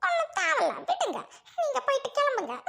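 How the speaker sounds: high, tearful brush-off; wounded, crying dismissal; tearful sulk. Acted. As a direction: send him away through tears. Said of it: "அழாதம்மா".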